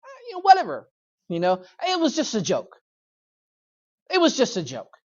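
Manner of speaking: airy, dismissive shrug-off; careless shrug. Acted. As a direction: brush it off like it's nothing. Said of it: "he's careless, he's reckless".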